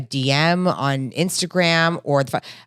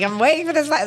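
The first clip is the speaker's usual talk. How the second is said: much higher, playful put-on voice; mock disbelief; 11 semitones higher, faster than usual. Telling her co-host about Greg, high mock disbelief. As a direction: squeak it out in mock amazement.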